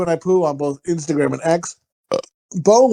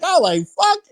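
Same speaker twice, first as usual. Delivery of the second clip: laughing shock; 11 semitones higher than usual. Retold or imitated. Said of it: "And you're like".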